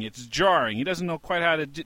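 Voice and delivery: ordinary delivery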